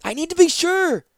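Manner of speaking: high, loud shout. Acted. Zancha shouting to Ratapi, high and loud. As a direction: shout it in doubt. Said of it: "she shouted".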